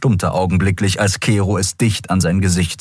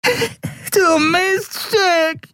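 high, slow, howling with pain; yelping; much slower than usual. A narrator's reading. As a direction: wail it out through a broken nose. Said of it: "jaulte er".

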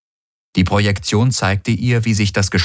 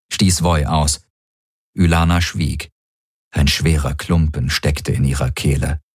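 ordinary delivery

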